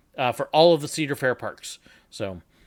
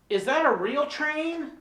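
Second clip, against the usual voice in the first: high-pitched clueless tourist voice; dumb tourist; 11 semitones higher, slower than usual. Acted. Tourist asking train worker about schedule, high clueless voice. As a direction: ask it high and naive. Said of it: "my dumb tourist".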